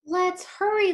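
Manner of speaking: put-on high, loud, hurried kid's voice; a child rushing her parents; eager little girl. Girl character voiced in story.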